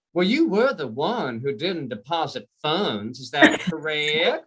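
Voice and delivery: slow Georgia drawl, nasty underneath; slow, sugary Georgia bank lady; sweet-nasty Georgia accent. Acted. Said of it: "that same sweet accent, but she was being nasty".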